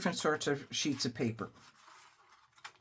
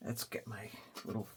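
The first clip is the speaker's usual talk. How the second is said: whispering; 5 semitones lower, 13 dB quieter than usual. Real, not acted. Quietly narrating craft work.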